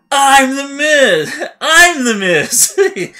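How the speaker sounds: very loud, high, slow wrestler catchphrase; hammed-up imitation of a showboating wrestler; self-important announcement. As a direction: bellow it grandly, stretching every word. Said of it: "He said it like that".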